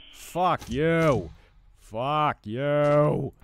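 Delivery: loud furious curse; raging gamer. Real, not acted. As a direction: yell it at the screen. Raging at enemy.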